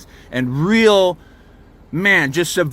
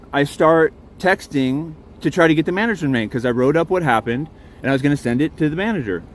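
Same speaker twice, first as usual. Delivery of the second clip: usual speaking voice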